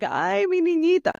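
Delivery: high, doting "Ay, mi niñita"; tender Spanish endearment. Retold or imitated. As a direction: coo it like a doting dad. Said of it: "it wasn't like this".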